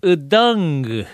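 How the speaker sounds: うどんぐ said slowly with the final g sounded; うどんぐ with an added g, mock spelling-pronunciation; spelling pronounced. Shown as a demonstration. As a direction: read うどんぐ letter by letter, sounding the g. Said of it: "発音しない字ですね".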